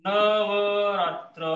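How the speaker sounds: Navratra dragged out very slowly; slow dictation of Navratra; slowed demo. Shown as a demonstration. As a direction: stretch every syllable of Navratra. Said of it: "थोडंसं मोठं अक्षर काढतो".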